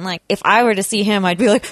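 ordinary talk, nothing marked

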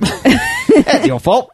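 laughing attempt at Australian; mock Australian accent. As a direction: stretch it out in a silly Australian accent. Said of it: "But he's Australian".

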